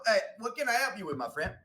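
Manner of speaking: sudden cheery greeting. Acted. Clerk suddenly greets customer.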